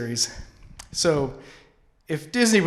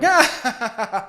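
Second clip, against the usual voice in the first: mock alarmed cry; 8 semitones higher than usual.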